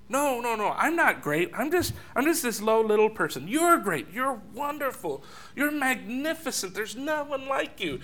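mock-modest bride heaping praise on her beloved; gushing bride. Bride arguing she's not great, he is: gushing protest. Lovers' praise battle acted out.

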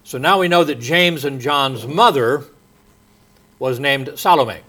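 ordinary delivery

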